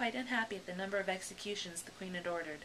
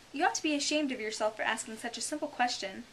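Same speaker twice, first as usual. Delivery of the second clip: higher-pitched reproachful griffin; scolding; 5 semitones higher, 4 dB louder than usual. Acted. The Griffin reproving Alice for a simple question, scolding. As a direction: shame her for asking.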